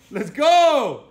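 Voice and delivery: loud, high laughing shout; laughing cheer. Real, not acted. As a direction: cheer her on through laughter.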